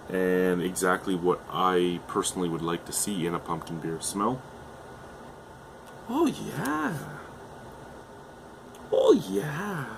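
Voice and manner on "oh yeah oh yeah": high, drawn-out pleased oh yeah; savoring oh yeah. Real, not acted. Reviewer smelling the pumpkin ale, high delighted savoring.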